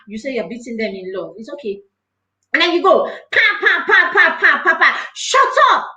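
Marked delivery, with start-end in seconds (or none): 3.0-5.1 sharp, higher, louder smack sounds; mock cane smacks. Speaker imitating a parent caning, sharp mock smacks. 5.1-5.9 high sharp shout; angry yell. Retold or imitated. Parent yelling at the child to shut up, high and sharp.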